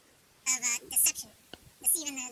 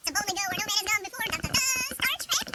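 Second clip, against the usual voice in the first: rising, louder hammed-up Star Trek intro; Star Trek announcer; 6 semitones higher, 4 dB louder than usual. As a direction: sell it like the show's opening fanfare.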